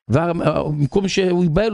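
usual speaking voice

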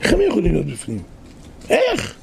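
fast question ending in a squeal; amazed disbelief. Acted. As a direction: marvel aloud at the impossible. Marvelling at ark capacity.

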